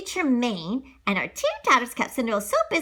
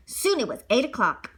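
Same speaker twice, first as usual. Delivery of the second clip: ordinary delivery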